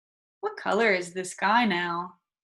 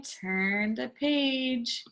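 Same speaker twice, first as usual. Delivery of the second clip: very slow, softer model line; slow model phrase; 2 semitones higher, 4 dB quieter, much slower than usual. Shown as a demonstration. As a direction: stretch each word for the children to repeat. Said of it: "Let's touch and say".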